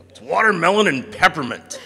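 high, loud imitated announcement; proudly spring the silly answer; gleeful reveal. Friend revealing the soda flavour, loud and gleeful.

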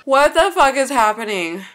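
loud, high cry of disbelief; alarmed outburst. Real, not acted. Viewer watching the daughter being taken, loud alarmed outburst.

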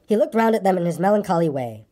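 plain reading voice

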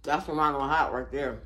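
chewing while talking; talking between bites; mouth full of food. Real, not acted. Seen 3: Lips pursed, jaw working on food after pizza bites.

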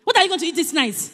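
normal talking voice

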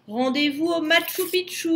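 high, called-out challenge; Yeti's roaring reply. A narrator's reading. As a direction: call the meeting place from afar. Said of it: "gronda le Yéti".

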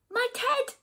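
high-pitched storybook gasp of recognition. A narrator's reading. Bear spots its own teddy, startled gasping cry. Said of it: "gasped the bear".

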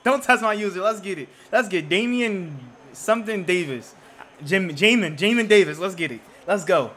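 loud, high-pitched gloating after an interception; real hyped-up yelling, bursting in high; excited shouting. Real, not acted. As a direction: crow at the opponent, fired up and loud. Celebrating an interception in game.

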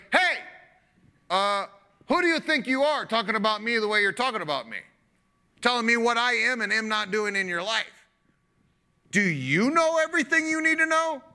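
God demanding who Job thinks he is; God confronting. Acted. God in the whirlwind confronts a complaining Job, indignant. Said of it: "He's like".

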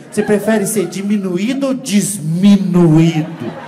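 desminuído drawn out slowly and loud, set against diminuído; slow loud contrast. Shown as a demonstration. Comedian slowly contrasting diminuído with desminuído. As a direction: spell out diminuído then desminuído, very slowly and loudly. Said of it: "a palavra diminuir é muito melhor".

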